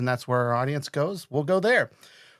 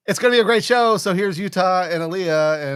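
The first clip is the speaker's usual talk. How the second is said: raised, loud co-host introduction; host build-up; 4 semitones higher, 6 dB louder than usual. Real, not acted. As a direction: announce your guests with a big lift.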